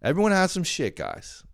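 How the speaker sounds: high-pitched placating voice; playful, squeaky-high reassurance; mock reassurance. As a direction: sing it out high to soothe them. Reassuring the family in silly voice.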